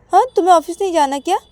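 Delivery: fast, high, loud questioning; nagging demand. Acted. Payal chides Ananya about office, nagging demand. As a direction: nag sharply in one quick burst.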